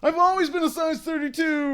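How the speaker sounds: a high, loose stab at a sitcom comic's voice; Seinfeld impression. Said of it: "can't do an impression of Seinfeld".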